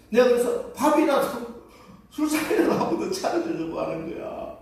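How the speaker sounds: pleading with him as if about to cry; pitying plea. Retold or imitated. Wife tearfully pitying her husband's fate in hell.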